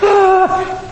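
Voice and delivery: excited squeal. Retold or imitated. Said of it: "They're like".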